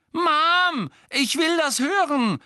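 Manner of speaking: put-on very high, loud girl's plaint; wailing complaint. A narrator's reading. Ginny pleading with her mother to stay, wailing. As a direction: complain at Mum in a high wail. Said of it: "klagte Ginny".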